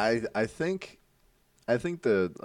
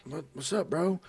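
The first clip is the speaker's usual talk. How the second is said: just-woken friend; 7 semitones higher than usual. Retold or imitated. Imitating Javen answering the door, just-woken voice. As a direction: answer the door half-awake. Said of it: "he's, like, waking up".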